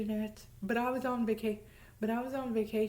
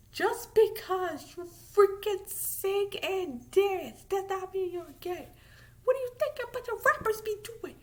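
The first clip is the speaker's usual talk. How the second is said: exasperated rant; 6 semitones higher, 4 dB louder than usual. Real, not acted. Ranting at the football players.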